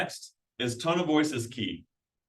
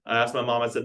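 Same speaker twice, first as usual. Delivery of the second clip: normal talking voice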